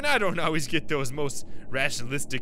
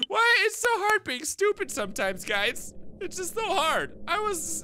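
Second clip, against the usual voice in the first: whiny self-pity. Real, not acted. Self-mocking whine after mistake.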